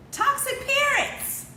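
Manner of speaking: high, loud, slow burst; emphatic exclaim. Real, not acted. Telling viewers what her son grew up with, a loud slow burst. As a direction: land the phrase hard. Punchline about her son's upbringing.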